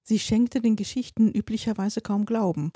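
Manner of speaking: ordinary delivery, nothing marked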